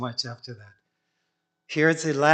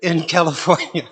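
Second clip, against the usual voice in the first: laughing; 3 semitones higher, 8 dB louder than usual. Real, not acted. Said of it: "laugh heard".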